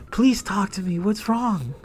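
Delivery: soft, high-pitched plea; high pleading. Retold or imitated. Boy coaxing silent classmate to talk, high pleading. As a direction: plead gently in a small high voice. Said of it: "I'm really talking like that".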